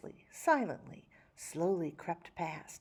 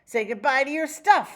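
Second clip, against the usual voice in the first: gleeful; 5 semitones higher, 9 dB louder than usual. A narrator's reading. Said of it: "Fresco boogied with glee".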